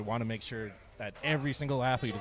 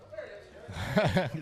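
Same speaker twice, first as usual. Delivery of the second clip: higher-pitched burst of laughter; real laugh; 8 semitones higher than usual. Real, not acted. Commentator after lifter's dizzy moment, amused laugh.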